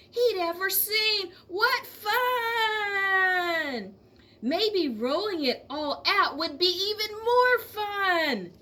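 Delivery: excited glee. A narrator's reading. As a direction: squeal with fun at the idea. Excited George, laugh heard.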